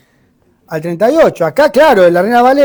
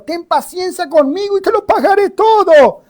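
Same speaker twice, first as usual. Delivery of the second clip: higher, begging fellow servant; pleading servant; 10 semitones higher than usual. Acted. Fellow servant begging on his knees, pleading. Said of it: "le rogaba diciendo".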